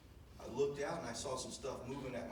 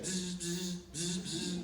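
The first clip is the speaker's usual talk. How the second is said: higher buzzing mouth noise; imitated buzz; 5 semitones higher, much slower than usual. Son imitating the buzz of the radio. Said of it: "just like Brother Penn said".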